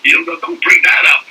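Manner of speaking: high-pitched mock protest; Mel Brooks. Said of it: "whenever I bring that up to Mel".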